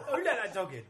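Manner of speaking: high, loud laughing. Real, not acted. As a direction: crack up while asking. Laughing line.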